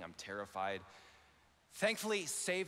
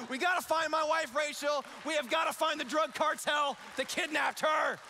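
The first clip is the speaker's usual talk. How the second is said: louder, higher urgent rush; action-movie panic; 8 semitones higher, 4 dB louder than usual. Retold or imitated. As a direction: play it like a thriller hero. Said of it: "my life becomes a movie".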